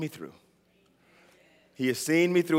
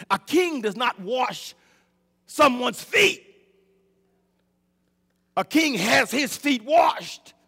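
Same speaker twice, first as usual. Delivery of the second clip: preaching emphasis; 4 semitones higher, slower than usual. Acted.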